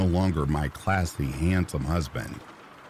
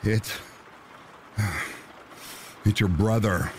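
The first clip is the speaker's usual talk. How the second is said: out of breath; 4 semitones higher than usual. A narrator's reading. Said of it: "said to me between breath".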